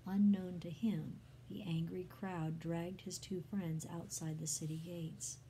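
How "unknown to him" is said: plain reading voice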